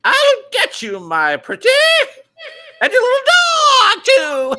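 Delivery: high, loud screeching witch; Wicked Witch of the West. Acted. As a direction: shriek the threat like a storybook witch. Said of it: "You gotta do that Wicked Witch voice again".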